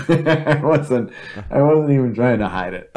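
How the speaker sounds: laughing through the words, pitch jumping up. Real, not acted. Laughing to a friend about sneaking down front. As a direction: giggle while admitting it.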